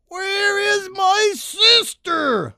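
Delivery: high, slow, drawn-out demand; slow demanding cry. A narrator's reading. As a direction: demand an answer, stretching it. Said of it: "I demanded to know".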